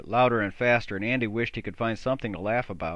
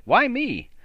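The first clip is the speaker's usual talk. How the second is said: indignant; 13 semitones higher, 6 dB louder than usual. Acted. Tom protests indignantly.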